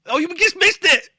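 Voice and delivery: high, loud excited cry; excited exclamation. Real, not acted. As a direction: blurt it out, thrilled. Host exclaims at bleeped moment.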